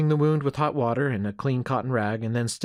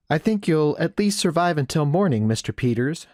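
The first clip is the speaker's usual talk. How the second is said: higher, louder woman's voice; pitched-up motherly verdict; frontier nurse; 6 semitones higher, 6 dB louder than usual. Acted. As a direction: pronounce on your patient as the hostess. Voicing Missus McNeil.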